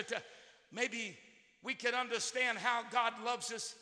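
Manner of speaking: ordinary talk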